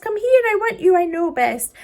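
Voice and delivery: loud, high summons; commanding call. Acted. Boy calling the sunset to him, loud command.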